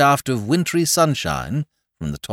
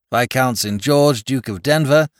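formal court proclamation; 4 semitones higher, 4 dB louder than usual. A narrator's reading. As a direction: intone the titles in court. Titles within the indictment.

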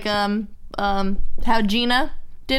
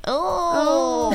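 Chelsea voice; 6 semitones higher than usual. Acted. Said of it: "You can do a good Chelsea voice".

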